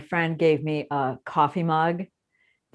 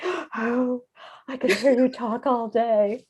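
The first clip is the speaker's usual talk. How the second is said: breath-catching mock swoon, higher pitch; swooning American fan; 6 semitones higher, slower than usual. Host imitates Americans hearing British accents, mock swooning. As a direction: gush as if smitten by the accent.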